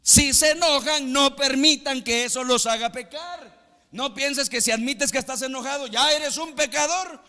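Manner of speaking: high, loud fiery sermon delivery; full-force preaching. Acted. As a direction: preach it with fire. (predicación a todo pulmón)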